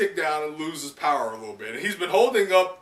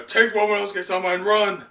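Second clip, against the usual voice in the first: higher, louder urgent order; urgent Kinemon; 7 semitones higher, 6 dB louder than usual. Acted. Kinemon ordering the escape, urgent and loud. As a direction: command them to flee.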